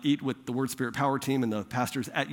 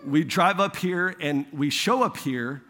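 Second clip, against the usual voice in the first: ordinary talk, nothing marked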